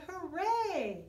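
plain reading voice

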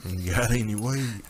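a spaced-out hippie; hippie stoner voice. Pretend. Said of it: "hippie stoner voice".

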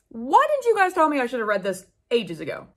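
ordinary talk, nothing marked

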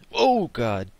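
loud, sudden gasp of alarm; startled cry. Real, not acted. Gamer startled by danger, loud alarmed cry.

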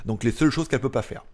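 ordinary talk, nothing marked

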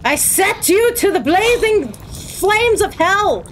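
high, hammed-up damning cry; mock exorcist curse. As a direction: pronounce a high theatrical curse on demons. Theatrical curse at game demons.

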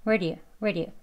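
"where do you" squeezed into one quick sound. Shown as a demonstration. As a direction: rush "where do you" together with a flicked d. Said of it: "더 빨리 발음을 하게 되면".

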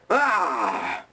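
sudden high loud yell; angry roar. Acted. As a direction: burst out in a rage cry. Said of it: "my angry side".

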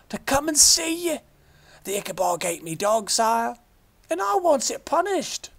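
high, loud rustic outburst; excited old shepherd. A narrator's reading. Shepherd pleading to the king for vengeance, excited. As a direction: rush it out, full of fright.

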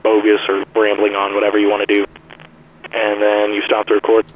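thin, band-limited phone call; like on an old handset; telephone voice. Shown as a demonstration. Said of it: "sounds like a telephone call".

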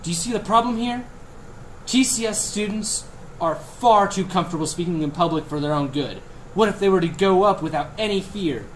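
snide TV pundit rant; hammy editorial punchline read; mock news commentary.

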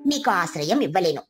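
low, scornful crow voice; low, haughty neighbour crow; rude crow. Acted. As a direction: look down on the sparrow from a low voice. Crow refuses and insults.

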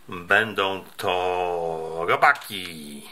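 drawn out, low and slow; slow dramatic reveal. Real, not acted. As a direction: let the reveal hang. Reveal of the surprise snack. (powolne dramatyczne ujawnienie)

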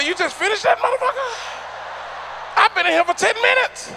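high-pitched mock amazement; mock disbelief. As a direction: act amazed he finally got it out. Mocking stuttering manager.

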